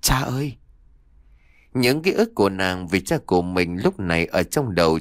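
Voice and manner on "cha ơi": higher-pitched, mildly tearful call to a father; understated grieving cry; choked-up cry. A narrator's reading. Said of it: "ngất nghẹn ngào".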